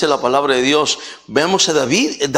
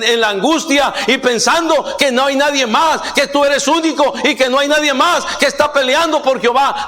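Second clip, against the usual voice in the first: raised, driving sermon voice, pitch higher; fiery preaching; 7 semitones higher than usual. Acted. Preacher at full force. (predicación enérgica, tono más agudo)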